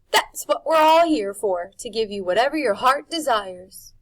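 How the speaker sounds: high, loud, syrupy charm; charming Rictus. A narrator's reading. Rictus promises Harvey anything, high and syrupy. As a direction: promise him everything, sweetly. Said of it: "his charm was working".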